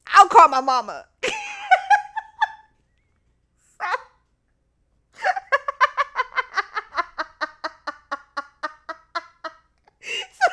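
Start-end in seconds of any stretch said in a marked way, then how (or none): 0.1-1.0 emphatic, much louder declaration; loud insistence. Retold or imitated. Student insisting to assistant principal, loud blurt. Insists on calling mama herself. 1.1-2.5 loud, very high cackle; real laughter. Real, not acted. Storyteller cracking up at her punchline, high laughter. 5.2-9.5 long high laughing fit; real laughter. Real, not acted. Storyteller overcome by her own story, long laughter.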